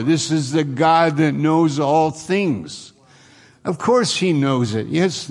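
fervent preacher urging the hearers; impassioned preaching. Acted. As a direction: plead with the congregation to grasp it. Preaching at full force.

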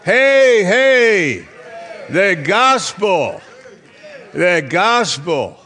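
high, drawn-out preacher's holler; shouting. Shown as a demonstration. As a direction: belt it out at full voice to rouse the room. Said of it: "How about shouting like I can?".

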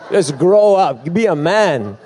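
half-joking copy of the pastor's call to man up; mock preacher shout. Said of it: "and he's like".

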